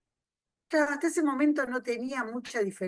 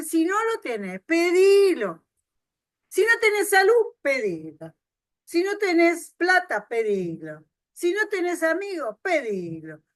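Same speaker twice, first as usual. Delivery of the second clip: proclaiming God's words; 6 semitones higher, 6 dB louder than usual. Acted.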